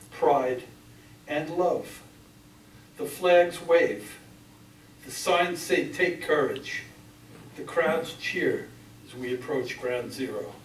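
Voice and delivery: measured, solemn poetry recital; grave spoken verse, firmly projected; poem reading. Acted. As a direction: read it out in deliberate phrases, pausing after each. Reads own poem aloud.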